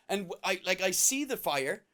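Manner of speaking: usual speaking voice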